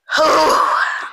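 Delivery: long, heavy exhale; exasperated sigh. Real, not acted. Pausing mid-rant about false accusations, exasperated sigh. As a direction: let out a long breath of exasperation.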